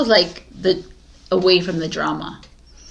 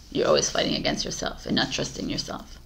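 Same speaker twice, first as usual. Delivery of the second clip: soft, voiceless repeat of the point; whispered aside; 6 semitones lower, 9 dB quieter, faster than usual. Real, not acted. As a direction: breathe the words, barely audible. Reading gently repeats the point.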